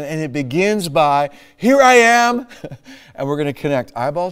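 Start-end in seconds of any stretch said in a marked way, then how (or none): 1.5-2.5 high, loud announcing call. Acted. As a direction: sing out your whereabouts across the house. Repeats wife's called answer.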